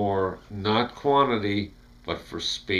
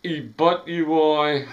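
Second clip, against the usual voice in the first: put-on E butt you boy, slow and higher; reversed; 7 semitones higher, 4 dB louder, slower than usual. Shown as a demonstration.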